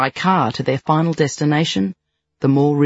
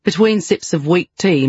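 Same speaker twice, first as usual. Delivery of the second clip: plain reading voice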